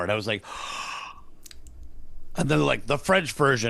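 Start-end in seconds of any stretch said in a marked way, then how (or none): 0.5-1.3 mock gasp. Told he is in Toy Story.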